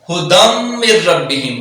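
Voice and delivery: ھدم من ربہم with ن merged and ر doubled; slow, merge each ن away. Shown as a demonstration. Teacher recites the phrase slowly showing the merge. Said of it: "مدغم کر کے پڑھنا ہے".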